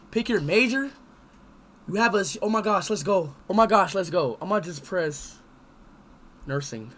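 normal talking voice

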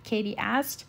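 ordinary delivery, nothing marked

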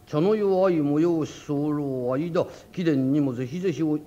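一語ずつゆっくり候文を読む; ゆっくりたどたどしく読み上げる; 手紙の読み上げ. Acted. 手紙を読み上げる. (候文をゆっくりたどたどしく読む)

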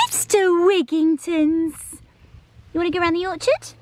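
high, excited call to the dog. Real, not acted. Owner calling her dog to the orchard, high and excited. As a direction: sing out for the pup.

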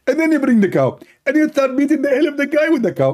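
high-pitched, emphatic put-on voice; insistent, high mock-divine instructions; Allah. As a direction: hand down orders in a comic high voice. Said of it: "Allah, he answered".

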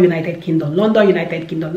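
ordinary talk, nothing marked

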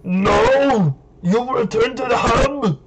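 lightly hammed Terminator protest; Arnold. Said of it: "in the Arnold voice".

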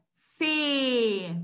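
"C" said as a drawn-out "seee"; teacher sounding out a long "se"; syllable demo. Shown as a demonstration. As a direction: hold the E in "C". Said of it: "eu ouço o som da letra C".

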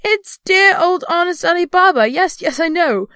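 high, loud, gushing delight; ecstatic exclamation. A narrator's reading. Scrooge greeting dear old Ali Baba, ecstatically. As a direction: bubble over with joy at an old friend. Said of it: "Scrooge exclaimed in ecstasy".

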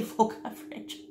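ordinary talk

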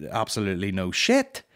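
usual speaking voice

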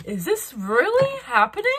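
mock overwhelmed cry of shock; hammed-up shocked wail. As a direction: wail it like you can't believe it. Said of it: "You're like".

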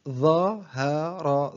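very slow, each syllable drawn out separately; broken into syllables. Shown as a demonstration. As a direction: sound out the word syllable by syllable. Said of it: "تقطع الكلمة إلى حروف". (تقطيع الكلمة ببطء شديد مقطعًا مقطعًا)

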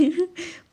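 a quick high burst of laughter; short laugh. Real, not acted. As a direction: laugh at your own joke. Laughs after her joke. (ऊँची आवाज़ में छोटी सी हँसी)

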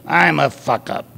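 a hint of smoker's roughness; tobacco-roughened voice. A narrator's reading. Brenda owns up to the group leader, lightly tobacco-roughened. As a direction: lightly voice a tobacco-worn woman. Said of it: "in her tobacco roughened voice".

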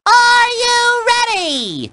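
high, long-drawn cartoon holler; rally the crowd with a stretched-out high cry; rousing call. Rallying the player before the song.

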